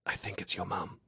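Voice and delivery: soft, breathy hush for the partner's ear alone; whisper. A narrator's reading. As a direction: breathe it gently close to the ear. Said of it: "leans in and whispers".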